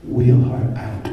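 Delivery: doctor giving a curt order; cold order. Retold or imitated. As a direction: deliver it like a cold verdict, slowly and loud.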